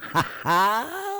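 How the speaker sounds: high, loud "ha ha"; mock laugh. Teacher caps his own joke, mock laugh. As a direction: punch out a fake laugh, drawing out the last ha.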